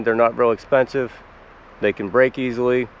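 normal talking voice

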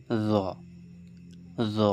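জ said light and thin, tongue tip near the teeth; জ as the light Arabic ذ sound. Shown as a demonstration. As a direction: keep জ soft and light, no heaviness. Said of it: "এই হালকা আওয়াজটি তৈরি হয়".

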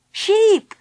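high, loud calls across a distance; urgent hailing cry, pitched high; shouting. A narrator's reading. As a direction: shout to catch their attention. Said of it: "shouted Philip and Baby Bee".

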